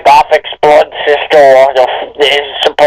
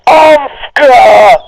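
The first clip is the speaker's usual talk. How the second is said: hammed-up scream; 8 semitones higher than usual. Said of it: "Or alternatively".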